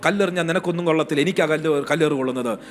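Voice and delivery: a hurried mock protest to God; fast complaint. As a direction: rattle off the complaint quickly. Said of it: "ഞാനായിരുന്നെങ്കിൽ പറയും".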